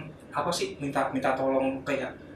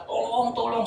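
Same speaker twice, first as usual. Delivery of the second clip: high-pitched mock cry for help; high, half-hearted scream; retold scream; 10 semitones higher than usual. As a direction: re-enact the yell without full volume. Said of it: "Gue teriak-teriak".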